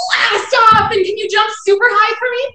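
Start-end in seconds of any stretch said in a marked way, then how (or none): 0.1-0.9 higher, louder launch cry; excited blast off. Acted. Teacher calling blast off to jumping kids, high and loud. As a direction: shout it like a rocket launching.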